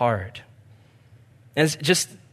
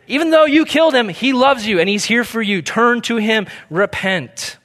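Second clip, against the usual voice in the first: high, loud, fast urgent appeal; urgent plea; 7 semitones higher, 5 dB louder than usual. Retold or imitated. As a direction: Peter pleading with the crowd, high and loud. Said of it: "he's like".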